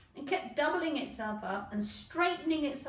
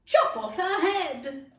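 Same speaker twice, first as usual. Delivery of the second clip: high, loud, slow bellow; Duchess bellowing; 6 semitones higher, 11 dB louder, slower than usual. Acted. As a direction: roar it out like a tyrant. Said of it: "said the Duchess".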